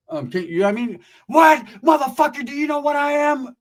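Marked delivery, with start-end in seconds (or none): 1.3-3.6 high, loud indignant outburst; mock angry yell. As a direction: yell at the stranger at the door. Acted angry homeowner.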